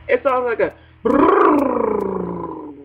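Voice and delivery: slow, drawn-out mock sneeze spray; comic sneeze sound effect; sneeze noise. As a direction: blow a wet brrr like a sneeze. Mimicking a messy sneeze.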